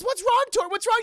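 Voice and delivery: high, loud worried questions; mock alarm. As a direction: imitate a woman alarmed by a barking dog. Voicing woman alarmed by barking dog.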